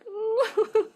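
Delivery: high tearful laugh breaking through; crying laugh. Real, not acted. Watching the horses video, laughing through real tears. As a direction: laugh and cry at once, overwhelmed. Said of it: "I hope you guys are crying because I'm crying".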